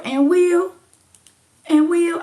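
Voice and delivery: high loud call to Will; shouting a name to get attention. Real, not acted. Calling out to Will.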